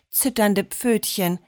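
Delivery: ordinary delivery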